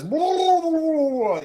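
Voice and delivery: high, loud nonsense chant; gibberish spell book voice. Retold or imitated. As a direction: babble like an arcane tome. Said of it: "his spell book says".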